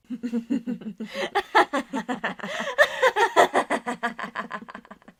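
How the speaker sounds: loud, long villain laugh; evil cackle. Acted. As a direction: cackle with wicked glee. Cackle after the blood drinks reveal.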